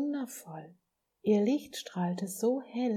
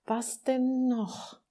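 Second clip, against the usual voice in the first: drawn-out, higher exasperated moan; impatient groan; 6 semitones higher, 4 dB louder, much slower than usual. A narrator's reading. Little soul groans at God's latest difficulty, impatient and drawn out. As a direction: groan it slowly, losing patience. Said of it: "stöhnte sie".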